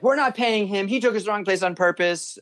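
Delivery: high, indignant complaint; wife fuming that the driver cheated them; mock angry wife. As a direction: imitate the angry wife accusing. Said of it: "She's like".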